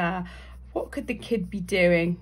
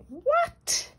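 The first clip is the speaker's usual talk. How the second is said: high startled cry; startled pedestrian. Acted. Pedestrian about to step out, high startled cry. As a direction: yelp it in shock.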